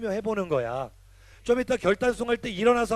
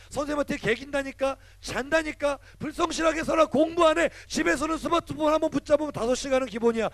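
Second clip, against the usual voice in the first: higher and louder, urgent warning; fired-up preaching; 7 semitones higher, 3 dB louder than usual. Acted. Pastor warns teens about school peer pressure, fired-up. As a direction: preach it hard, build the urgency.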